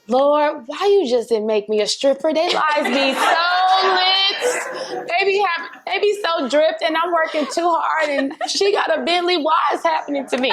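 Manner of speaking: high, crying complaint to the Lord; mock whiny lament. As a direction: wail it like a fed-up diva begging God. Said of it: "I'm feeling like".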